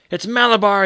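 loud high frenzied scream. Acted. Boy on the rocking horse screams to his mother, frenzied. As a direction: shout it out like a possessed child. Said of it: "he screamed in a powerful, strange voice".

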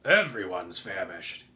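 put-on bug voice, low and loud; centipede character. A narrator's reading. Centipede speaks up for all the bugs, loud low complaint. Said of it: "he said".

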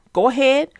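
ordinary delivery

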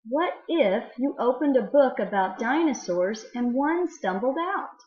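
lively storybook read-aloud with wonder; reading to children. Acted. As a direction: read it to the class like a fun picture book. Teacher reads a poem aloud.

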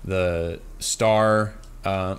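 ordinary talk, nothing marked

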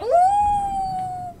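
loud high surprised whoop; high excited squeal. Real, not acted. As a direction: squeal as it barely works. Narrow escape in game.

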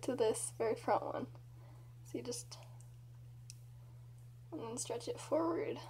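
lips pulled aside by fingers; talking while fitting braces band; fingers in mouth. Real, not acted. Seen 0: Fingers inside mouth placing elastic on braces, pulling lips aside.

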